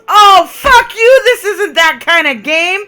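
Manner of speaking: a laughing, disbelieving howl; laughing outraged yell. Real, not acted. Game refuses her romance move.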